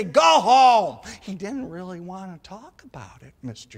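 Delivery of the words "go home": loud, curt brush-off; gruff retort. Retold or imitated. Garbage man shooing nosy kids, loud curt. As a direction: bark it like a grumpy neighbor. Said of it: "And he'd say".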